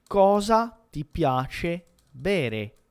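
drawn out slowly, word by word; slow model question. Shown as a demonstration. Teacher models a question for learners, slow and deliberate. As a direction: spell out the question at learner pace.

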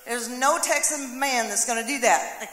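ordinary talk, nothing marked

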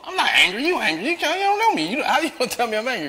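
high-pitched defensive comeback; mock defensive. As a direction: protest hotly while half laughing at yourself. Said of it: "I'm on the defense mode".

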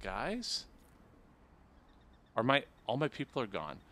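normal talking voice

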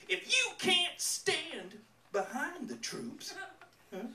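mock the bumper sticker in a Southern accent. Comic reading a bumper sticker aloud, mock Southern accent. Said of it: "in a thick Southern accent".

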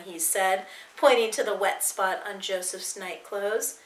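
usual speaking voice

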